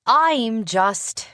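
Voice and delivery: high, loud pouty start; girlish pout. A narrator's reading. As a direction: begin a sulky protest. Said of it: "she pouted".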